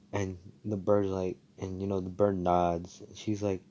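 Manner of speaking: ordinary delivery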